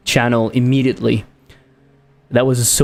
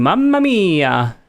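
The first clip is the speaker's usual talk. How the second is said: slow, drawn-out mock Italian; mock Italian exclamation; 5 semitones higher, much slower than usual. Picturing a tourist yelling in Italy, mock Italian accent. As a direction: stretch it out like a caricature Italian. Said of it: "start screaming".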